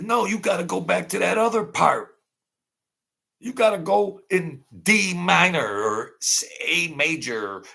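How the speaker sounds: a mild mock argument, insisting on the point; mock arguing. Streamer retells a bandmate arguing in the studio, mildly heated. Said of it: "They were arguing like they were ready to fight".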